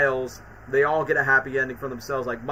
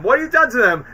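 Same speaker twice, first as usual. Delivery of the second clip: angrier repeat; 8 semitones higher, 8 dB louder than usual. Retold or imitated. Norman confronting both Spider-Men, louder angry repeat. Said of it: "where he's like".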